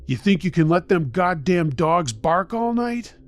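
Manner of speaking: put-on slurring drunk. A narrator's reading. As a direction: challenge them as a belligerent drunk. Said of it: "He was slurring his words terribly".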